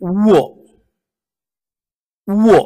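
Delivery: উও said with rounded lips, pushed from the throat; উও টেনে, মুখ গোল করে; rounded w sound. Shown as a demonstration. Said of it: "বমি করার মতো করে উচ্চারণ করতে হবে". (উও গোল ঠোঁটে জোর দিয়ে)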